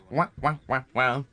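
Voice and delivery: mouthed wah-wah sting; imitated punchline jingle; sitcom music. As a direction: sing out a mock comedy trombone cue. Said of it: "sitcom music".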